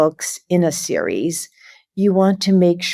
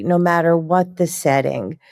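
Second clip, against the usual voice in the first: usual speaking voice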